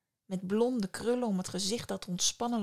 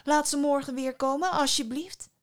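higher, louder begging; imploring, pitched up and pushed; pleading cry; 6 semitones higher, 5 dB louder than usual. A narrator's reading. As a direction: beg him eagerly to give in. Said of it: "riep ze uit".

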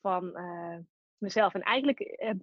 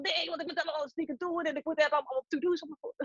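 rushed, higher mock fretting; flustered protest; 6 semitones higher, faster than usual. Her busy nature resisting a week off, mock-flustered and rushed. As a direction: tumble through it like an overwhelmed to-do list keeper. Acted protest against letting go.

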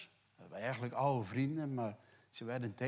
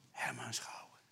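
whispered ending; 7 dB quieter than usual. Acted. End of Job's quote, hushed.

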